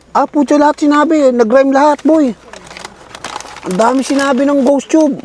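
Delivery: high, loud amazed outburst; excited. Real, not acted. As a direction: blurt it out in disbelief. Amazed the app rhymed everything.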